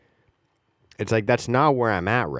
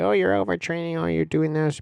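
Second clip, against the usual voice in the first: much higher, mocking know-it-all voice; mocking scientists; 8 semitones higher than usual. Scientists warning him about overtraining, in a high mocking voice. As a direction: pitch it way up to mock the experts. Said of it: "scientists will be like".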